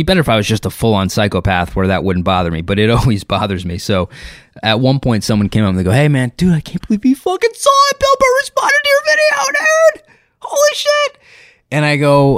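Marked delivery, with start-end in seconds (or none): 5.9-11.2 very high, strained squealing excitement; over-the-top starstruck dude; amazed fan. Retold or imitated. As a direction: build from "hey, man" into a mock high-pitched shriek.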